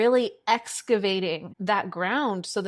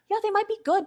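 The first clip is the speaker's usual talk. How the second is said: mock dismissive; 12 semitones higher, much faster than usual. Mocking men who seem good.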